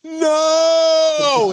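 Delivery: long, high, hammed-up cry of "no"; movie-hero scream. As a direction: wail it like diving on a grenade. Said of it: "one of those scenes in the movies where somebody jumps on a grenade".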